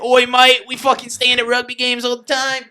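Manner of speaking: loud, hammed-up Australian accent; mock Australian accent. Friend mocks an Australian rugby fan, hammed-up Australian accent. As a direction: play a rowdy Aussie rugby fan in Australian. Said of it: "you have the Australian".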